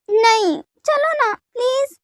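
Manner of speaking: high, slow, drawn-out begging; pleading. Acted. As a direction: stretch it into a coaxing plea. वी फिर मनुहार करता है. (खींचकर मनुहार करना)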